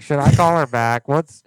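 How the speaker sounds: excited outburst that overloads the mic; mic-peaking loud. Real, not acted. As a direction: blurt it out loud and high. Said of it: "oh, my mic's peaking".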